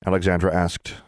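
usual speaking voice

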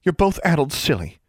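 put-on high-pitched girlish dismay; exasperated exclamation. A narrator's reading. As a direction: exclaim at their silliness. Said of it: "exclaimed Forbearance".

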